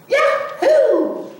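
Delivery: high, laughing whoop of joy; excited cry. A narrator's reading. Little cub cheering to Mama with a laugh. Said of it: "cried Little Cub".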